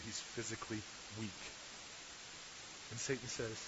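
quiet storyteller building tension; hushed dramatic storytelling. Acted.

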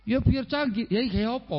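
a light take on the wife answering; wife's reply. Retold or imitated.

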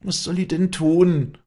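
usual speaking voice

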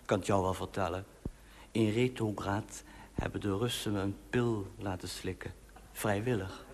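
quiet secretive murmur; softly. Acted. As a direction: lean in and say it softly. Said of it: "zei hij zachtjes".

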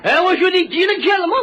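high Shandong dialect demand; imitated Shandong shopkeeper; Shandong butcher. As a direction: challenge the customer in thick Shandong speech. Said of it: "干嘛，张大爷".

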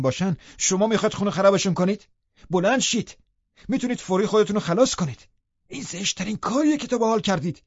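high-pitched, agitated scolding; angry. A narrator's reading. Servant berating the guest as master climbs stairs, angry. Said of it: "با عصبانیت گفتم".

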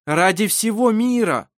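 joining the plea. A narrator's reading. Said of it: "добавил Даниэль".

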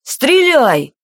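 high, urgent cry without much added volume; alarmed command. A narrator's reading. Alarmed guard orders another to fire, high urgent cry. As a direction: urge the shot in sudden alarm. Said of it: "встревоженно крикнул кто-то".

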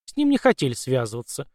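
ordinary delivery, nothing marked

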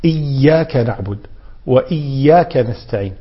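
firm held y in each إياك; doubled ya. Shown as a demonstration. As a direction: recite with إياك as "iyy-yaaka". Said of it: "بتشديد الياء".

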